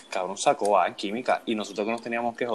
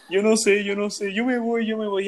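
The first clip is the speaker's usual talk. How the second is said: high, whiny fake sob; hammed-up crybaby wail, pitched way up; mock crying; 11 semitones higher, 7 dB louder, slower than usual. Classmate fake-crying to the teacher, high mock sobbing. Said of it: "él empezaba a llorar en el salón".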